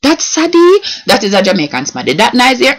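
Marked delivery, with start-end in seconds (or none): 0.0-0.8 high, drawn-out dismayed hum; dismayed reaction. Shown as a demonstration. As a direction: react to bad news, high and stretched. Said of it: "like something bad happen, and the person go".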